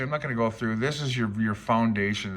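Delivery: usual speaking voice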